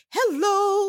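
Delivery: high, bright sing-song hello; sing-songy voice. Retold or imitated. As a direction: lilt it up like a fake-cheerful greeting. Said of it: "in this sing-songy voice".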